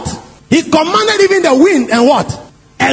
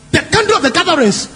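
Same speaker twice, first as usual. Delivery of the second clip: ordinary delivery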